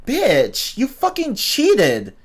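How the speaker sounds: high, slow retold accusation; mock outrage. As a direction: spit out the accusation in disbelief. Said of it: "I'm like".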